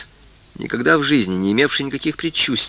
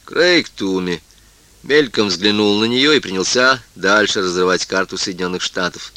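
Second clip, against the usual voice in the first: usual speaking voice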